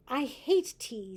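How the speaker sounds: stubborn little complaint; sulky boy. Acted. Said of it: "said the boy".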